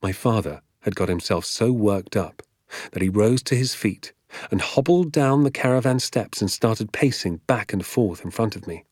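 ordinary delivery

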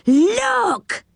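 high cry of astonishment; high-pitched amazed exclamation; astonished shout. A narrator's reading. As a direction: call out high in sudden amazement. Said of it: "gave a shout of astonishment".

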